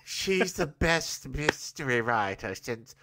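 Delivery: a slow, lightly played mid-Atlantic drawl; mid-Atlantic accent. Host voices Rhea in a mid-Atlantic accent. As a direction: purr it in an old-Hollywood mid-Atlantic accent. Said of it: "That's my mid-Atlantic accent".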